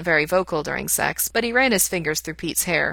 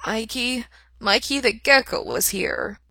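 higher, slow turtle voice; 5 semitones higher, slower than usual. A narrator's reading.

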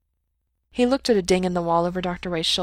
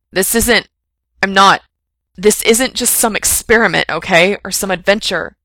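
loud, breaking outburst; blurted; 9 dB louder than usual. A narrator's reading. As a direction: blurt it out, overwhelmed. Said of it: "Frank blurted".

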